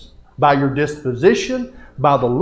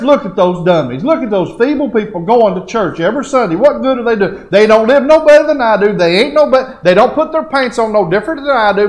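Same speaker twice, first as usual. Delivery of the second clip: mocking; 9 semitones higher, 7 dB louder than usual. Acts out people mocking churchgoers.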